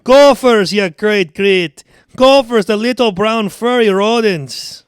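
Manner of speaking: loud, high Scottish bellow; angry Scottish accent. Acted. Boss scolding his groundskeeper Carl, angry Scottish shouting. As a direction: tear into him as an irate Scotsman. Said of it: "And he's, and he's angry".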